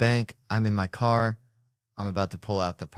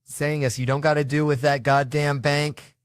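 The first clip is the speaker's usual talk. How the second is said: higher, louder mock snap; snapping; 6 semitones higher, 4 dB louder than usual. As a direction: bark it back, fed up. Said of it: "I snapped".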